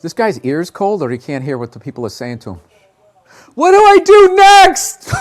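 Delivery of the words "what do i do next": high, slow booming yell; loud shout. Acted. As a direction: belt it out theatrically. Shouted out of nowhere.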